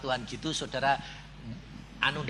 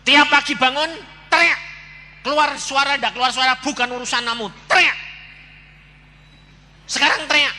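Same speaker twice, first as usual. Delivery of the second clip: loud, high, punched-out commands; forceful, raised-voice drilling; shouted orders; 10 semitones higher, 8 dB louder than usual. Retold or imitated. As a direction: order them to yell, at full voice. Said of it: "Aku bilang".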